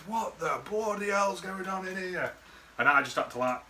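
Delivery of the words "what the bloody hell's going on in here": put-on high, slow, scandalised old lady voice; hammed-up nan catching kids misbehaving; mock outraged nan. Nan bursts in on the lads in the wardrobe, mock outraged, high and slow. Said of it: "your nan walked in and goes".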